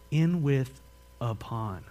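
ordinary talk

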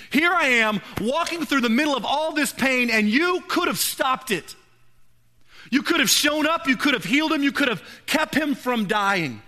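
grieving sister pleading with God, anguished; anguished plea. Retold or imitated. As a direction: accuse God through the grief, voice lifted.

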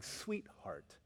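soft, low and tender. Retold or imitated. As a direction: say it like a loving father. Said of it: "what Jesus is saying is".

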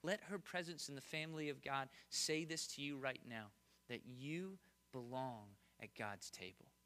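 soft tender preaching. Acted. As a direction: speak gently to someone hurting. Quiet intimate sermon opening.

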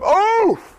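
sharp high oof at a bad smell; disgusted oof. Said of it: "would you wake up".

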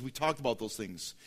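ordinary delivery

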